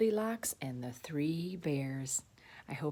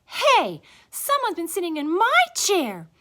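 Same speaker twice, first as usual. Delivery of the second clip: high, loud, indignant she-bear; Mama Bear; 12 semitones higher, 9 dB louder than usual. A narrator's reading. Mama Bear looking at her chair, high loud voice. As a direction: cry out high and offended. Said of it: "Mama Bear looked at hers".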